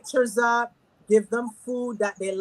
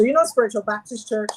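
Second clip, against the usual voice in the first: normal talking voice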